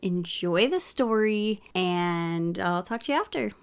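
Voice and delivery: ordinary delivery, nothing marked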